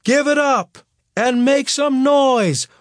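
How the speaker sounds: high, loud party-hyping call to the crowd; hype MC shout. Retold or imitated. DJ hyping the school hall crowd, loud MC shout. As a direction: pump up the crowd like an MC. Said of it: "I got on the mic".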